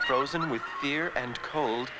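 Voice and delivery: plain reading voice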